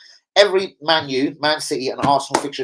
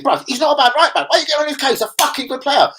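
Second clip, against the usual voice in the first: retold argument; 12 semitones higher, 4 dB louder than usual. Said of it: "I told him".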